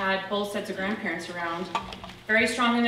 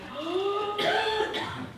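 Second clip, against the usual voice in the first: sharp gasp; 12 semitones higher than usual. Retold or imitated. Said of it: "And I was like".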